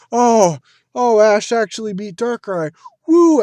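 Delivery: high, loud cheer ending in a whoop; relieved victory cheer. Acted. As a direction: act out a relieved crowd celebrating. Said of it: "it was like a relief sort of, uh, victory".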